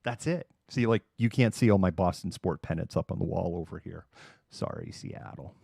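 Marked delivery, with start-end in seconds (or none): 4.5-5.5 slow, whispered mock apology; hushed aside. Real, not acted. Boston fan apologizing to Seattle about pennants, whispered.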